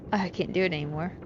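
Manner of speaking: normal talking voice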